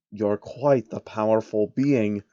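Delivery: a hint of a scheming old man; slow and measured; lightly voiced sly old man. Soros voiced.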